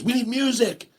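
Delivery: high-pitched imitation of a kid; playful high child voice; goofball daughter. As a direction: pipe up like a silly girl. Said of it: "Isla goes".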